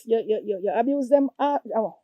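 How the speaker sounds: usual speaking voice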